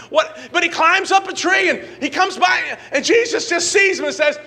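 high, loud, driving preacher voice; full-force preaching. Acted. Pastor preaching Zacchaeus to the church, full-force high and loud. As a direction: preach it at the top of your voice.

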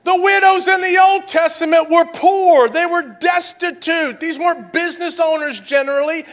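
loud, high preacher's build, hammering each point; preaching at full force. Acted. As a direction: preach it hard to the congregation.

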